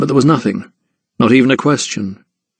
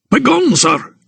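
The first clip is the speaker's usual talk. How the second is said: high-pitched, testy dismissal; snapped order; 13 semitones higher than usual. A narrator's reading. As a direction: snap at him to leave. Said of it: "he snapped".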